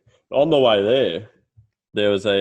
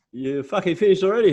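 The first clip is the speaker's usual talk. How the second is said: high, louder gruff surprise; old Italian farmer; 10 semitones higher, 5 dB louder than usual. Retold or imitated. Quoting the old farmer's surprise, higher and louder. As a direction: bark it like a surprised old boss. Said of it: "he's like".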